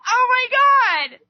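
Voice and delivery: exasperated shout at people's views; yell. Retold or imitated. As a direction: throw your hands up and shout it. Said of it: "I don't mean to yell right now".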